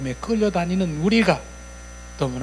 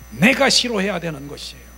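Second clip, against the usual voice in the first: normal talking voice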